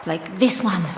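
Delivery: ordinary delivery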